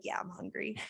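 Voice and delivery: very soft, small voice; sheepish admission. Retold or imitated. Hungry friend admitting why she's cranky, meek. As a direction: own up meekly. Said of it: "And I'm like".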